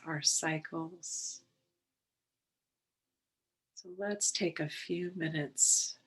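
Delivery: unhurried, calm and measured, settling them in; guided meditation. Real, not acted. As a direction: guide listeners gently into the breath, leaving space between phrases.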